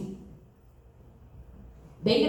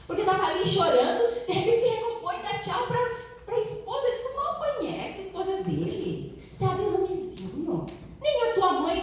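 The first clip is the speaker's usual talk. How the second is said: higher, mocking scold; sneering boss; 8 semitones higher than usual. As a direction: tease her like a liar caught out. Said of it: "ele olhou".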